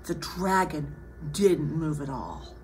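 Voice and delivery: low, soft storytelling; slightly suspenseful low hush; hushed narration. A narrator's reading. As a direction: tell it quietly, as beside a sleeper. Hushed narration beside sleeping dragon.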